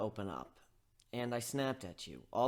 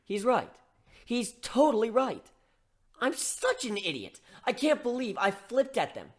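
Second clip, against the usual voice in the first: higher, agitated self-scolding; self-reproach; 7 semitones higher, 7 dB louder than usual. Acted. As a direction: kick yourself out loud. Hero berating himself inside.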